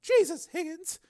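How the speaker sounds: high-pitched woman's voice, indignant. Acted. Susan to Higgins over his news, indignant. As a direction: protest in a high female voice.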